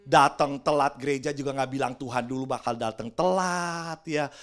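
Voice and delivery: ordinary talk, nothing marked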